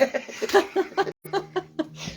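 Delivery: breathy laugh. Real, not acted. Laughs at colleague's remark.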